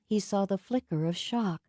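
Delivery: usual speaking voice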